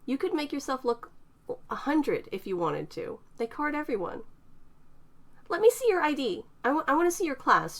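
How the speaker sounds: pushy, wanting to see; lightly voiced young woman demanding. A narrator's reading. Eterna demanding.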